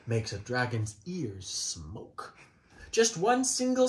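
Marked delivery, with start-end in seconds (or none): 1.5-2.4 soft whispered payoff; hushed spooky whisper; whispered word. A narrator's reading. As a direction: let the word drift off in a whisper. Dramatic trailing word.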